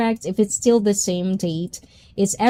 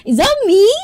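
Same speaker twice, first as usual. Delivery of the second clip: laughing, flattered and high; bashful laughing question; 12 semitones higher, 8 dB louder, faster than usual. Real, not acted. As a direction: giggle out the question, pleasantly surprised. Flattered by viewer's compliment.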